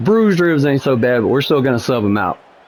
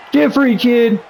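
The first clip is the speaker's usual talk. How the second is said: urgent high plea; 9 semitones higher, slower than usual. Real, not acted. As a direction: urge the player on, pitched up. Repeats it higher.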